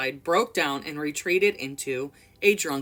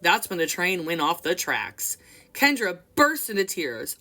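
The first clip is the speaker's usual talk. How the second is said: usual speaking voice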